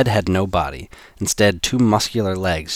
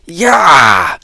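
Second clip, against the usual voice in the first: high loud yelp of shock; sudden cry as the gun flies; pained yelp; 10 semitones higher, 10 dB louder than usual. Acted. Gun blasted from hand.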